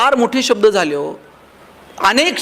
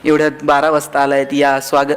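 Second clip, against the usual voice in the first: mock-sweet sarcastic wife greeting, lower pitch; annoyed wife mockingly greets husband home at midnight; sarcastic welcome; 8 semitones lower than usual. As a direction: lightly imitate a wife's sarcastic welcome. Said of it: "कोणती बायको प्रेमानं दार उघडेल?".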